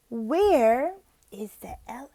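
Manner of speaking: slow, breathy searching whisper; whispered wondering. Shown as a demonstration. Voicing teacher searching with child.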